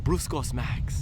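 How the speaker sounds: Brooks Ghost Max slowed right down, consonants melted away as pruss coss max; mushy, consonant-free name. Shown as a demonstration. Reviewer savoring the shoe name, mushy and slow. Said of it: "I feel like I have to say it like that".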